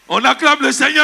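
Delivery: high preacher's call; rallying shout. Real, not acted. Preacher urges congregation to applaud the Lord, rallying shout. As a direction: call the whole church to praise.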